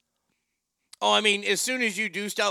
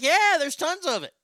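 higher, hyped-up sales pitch; eager scorpion; 7 semitones higher than usual. Acted. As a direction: sell it eagerly, pitch jumping up. Scorpion selling the trip.

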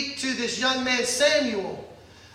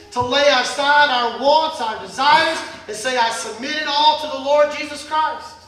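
rising, louder pulpit preaching; 2 semitones higher, 3 dB louder than usual. Acted. Pastor urging his church to submit, preaching hard. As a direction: drive the point home to the congregation.

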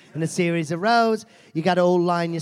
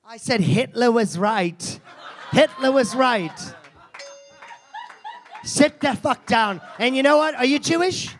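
loud, higher shock-comic rant; mock rage; 7 semitones higher, 4 dB louder than usual. Host yelling at the volunteer, mock rage. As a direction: hammer the outrageous line home.

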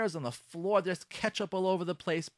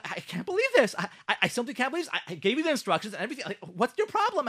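higher, fast and sputtering; exasperated outburst; 7 semitones higher, faster than usual. Pretend. Wife yelling at husband over the broken light, exasperated. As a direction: burst out at him, beside yourself.

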